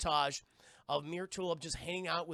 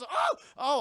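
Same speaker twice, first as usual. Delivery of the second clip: higher, louder startled stammer; 10 semitones higher, 9 dB louder than usual. Retold or imitated. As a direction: caught off guard and jumpy. Kid caught off guard.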